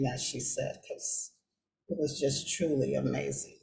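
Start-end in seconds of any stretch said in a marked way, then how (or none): none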